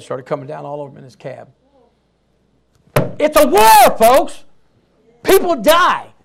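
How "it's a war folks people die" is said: loud, high, forceful proclamation; shouting from the pulpit; preacher's shout. Real, not acted. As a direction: thunder it out to the congregation.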